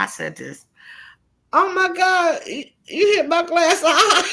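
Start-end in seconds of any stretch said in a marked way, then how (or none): 1.5-4.3 high, loud, slowed and laughing; mock panic dissolving into laughter; laughing through the line. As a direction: crack up while retelling the panicked plea. Her panicked line after the punch.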